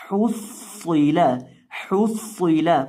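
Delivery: हुस्सिला with a long, heavy doubled s; correct thick reading. Shown as a demonstration. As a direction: repeat हुस्सिला deliberately, thickening the s. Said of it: "उनको मोटा करके पढ़ते हैं". (حُصِّلَ موٹے، مشدد صاد کے ساتھ)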